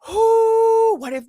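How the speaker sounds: high, loud delighted ooh; excited squeal. Real, not acted. She squeals at the clip, thrilled and loud. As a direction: squeal with glee at the gossip.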